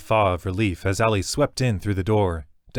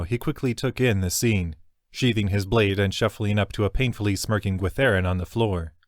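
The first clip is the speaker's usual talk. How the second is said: usual speaking voice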